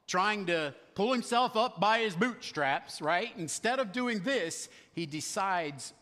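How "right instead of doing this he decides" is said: ordinary talk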